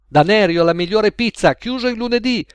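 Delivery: loud, high plug for his pizzeria; shouted advert. A narrator's reading. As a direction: bark it like a market crier. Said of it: "gridò un avventore".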